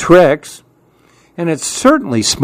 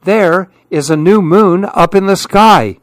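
excited call; 6 semitones higher than usual. Acted. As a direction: point it out with glee. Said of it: "called to the others".

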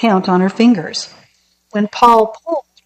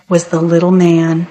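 plain reading voice

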